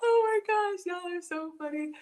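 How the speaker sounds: high, squeezed voice after a laughing fit; helpless laughter. Real, not acted. Host to viewers who cracked her up, helpless laughter. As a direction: barely get it out through laughter.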